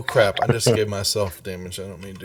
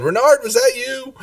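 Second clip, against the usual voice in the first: very high, louder squeal; mimicked outcry; 5 dB louder than usual. Game master repeating the question to Renard, squealed. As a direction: squeal it in mock accusation. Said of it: "No, you said".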